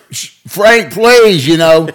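high, loud exasperated plea; pleading teacher. Retold or imitated. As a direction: beg him to stop.

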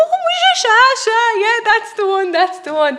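high, loud imitation of an old island man; old neighbour's voice. Old neighbour answers the folklorist's question, high and loud. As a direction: mimic the old neighbour cheerfully agreeing.

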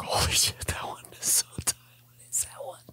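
hushed whisper over the bagels; sniffing four bagels, hushed whisper of wonder; awed whisper. Retold or imitated. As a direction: whisper like it's a sacred secret.

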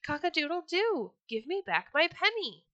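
high, loud crow and demand. Acted. Rooster at the Sultan's window crowing, high and loud. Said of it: "cried as he had before".

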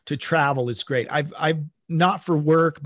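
usual speaking voice

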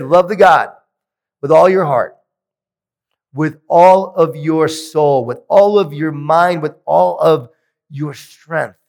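preaching at full force. Acted. Speaker quoting Jesus to the crowd, preaching. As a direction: proclaim it like a preacher.

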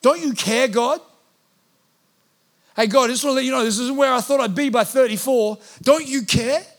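venting at God, pushing harder each line; frustrated plea to God. Retold or imitated. Pastor venting to God about his disappointed hopes, building to a shout. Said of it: "This is familiar language for me".

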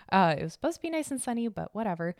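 usual speaking voice

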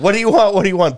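high, loud mock cashier yell; yelling woman. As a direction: keep barking the question. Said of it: "she kept yelling".

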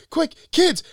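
very high, loud mock-frantic call; hammed-up cartoon hero shout; imitating urgent yell. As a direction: yell it to the kids before time runs out. Robin grapples to camera, yells to kids.

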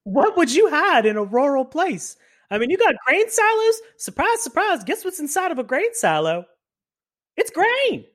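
very high, exasperated explaining; mock incredulity. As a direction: spell out the obvious, pitch soaring. Incredulous about hiding things in silos.

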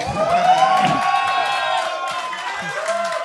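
very high, loud shrieking laugh. Real, not acted. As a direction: burst into a shrill cackle. Big laugh after souverainiste.

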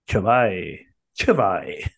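attempted Spanish accent, Chuvay ending like "eye". Shown as a demonstration. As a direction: try Chuvay as a Spanish word. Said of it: "try saying that in a Spanish accent".

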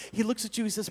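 ordinary delivery

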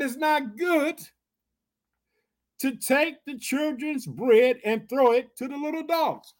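high, slow, drawn-out reading; emphatic quotation. Acted. Preacher repeating Jesus's hard answer to her, emphatic quotation. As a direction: pitch it high and stretch out each phrase.

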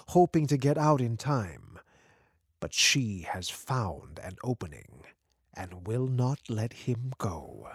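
plain reading voice

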